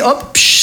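whispered pop and hiss; whooshing lift-off. Acted. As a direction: make the sound of the car shooting up. Ride vehicle takes off.

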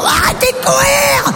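put-on high, slow shout at the children; yelling at the kids. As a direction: call the kids off across the garden. Crie après les enfants. (crie après les enfants, aigu et traînant)

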